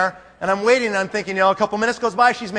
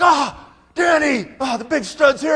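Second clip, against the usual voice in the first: higher, loud, cheering welcome; excited welcome; 7 semitones higher, 3 dB louder than usual. Retold or imitated. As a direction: greet the star like a crowd. Family cheering Danny's arrival.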